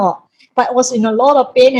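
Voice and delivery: usual speaking voice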